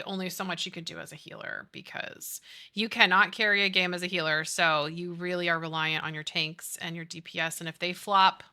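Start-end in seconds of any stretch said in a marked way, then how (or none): none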